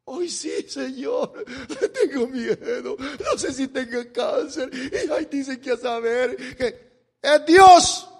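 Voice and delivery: high, put-on whimpering voice with laughs; mock frightened prayer. Mocking a sick person who only turns to God now, whimpering put-on voice. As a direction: parody a scared sick man suddenly praying.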